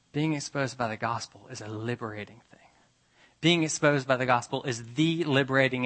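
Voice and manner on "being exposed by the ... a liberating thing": soft whispered statement; hushed. Shown as a demonstration. Preacher whispering the point to the congregation, hushed. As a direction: whisper it like a secret. Whispered contrast before restatement.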